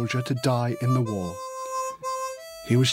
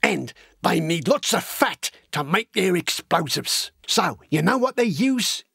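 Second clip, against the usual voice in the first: lively, wide-eyed working-class lad spinning war tales; excitable storytelling with big pitch leaps; eager young Cockney recruit; 4 semitones higher than usual. Acted. Horace telling Elsie gruesome war stories, eager and excitable.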